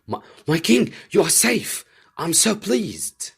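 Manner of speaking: high, loud, delighted greeting; joyful cry. A narrator's reading. Servant greets his returning king, overjoyed. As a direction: burst out in relief at the king.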